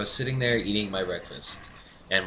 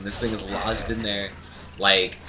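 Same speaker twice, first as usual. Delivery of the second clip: ordinary talk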